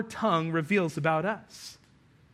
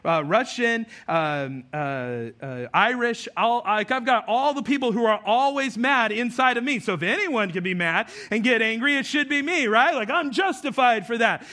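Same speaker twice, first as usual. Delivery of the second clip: self-justifying excuse; 5 semitones higher, 4 dB louder than usual. Retold or imitated. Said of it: "I've always said".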